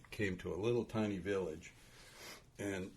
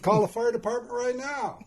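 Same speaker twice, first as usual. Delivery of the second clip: louder, higher, laughing through the order; laughing insistence; 9 dB louder than usual. Retold or imitated. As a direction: push harder while cracking up. Dad insists harder, laughing.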